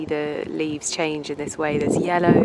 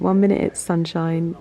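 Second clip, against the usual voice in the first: ordinary delivery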